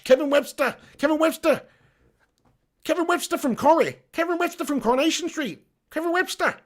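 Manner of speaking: high-pitched funny soap-character voice; Kevin Webster. Acted. Streamer tickled by a commenter's name, high funny-voice impression. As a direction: squeak the name in a comic impression. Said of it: "he's got a funny voice".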